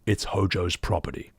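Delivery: lightly voiced brooding hero, low; low, solemn mock line; mock Vincent voice. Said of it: "That's actually what they've done in this character".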